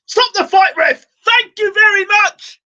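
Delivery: very high, loud, slow thank-you; shouted demand. Real, not acted. Fan yelling at the referee to stop the fight, loud. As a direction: yell at the referee, then mock thanks.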